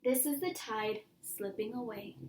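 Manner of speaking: plain reading voice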